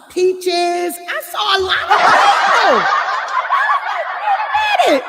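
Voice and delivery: high, loud retold outburst; excited surprise. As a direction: build to a hammed-up squeal. Retelling her surprise at seeing people.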